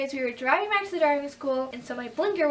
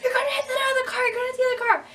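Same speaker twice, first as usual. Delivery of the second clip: fast, much higher, loud mock panic; mock screaming examiner; 12 semitones higher, 4 dB louder, faster than usual. Examiner yelling as she backs up, fast mock panic. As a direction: rattle it off in a shrill panic. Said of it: "he was screaming".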